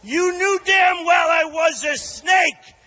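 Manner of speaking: dramatic poem reading to crowd; poem recital. Shown as a demonstration. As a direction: read the poem with theatrical flair. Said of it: "something written by [name]".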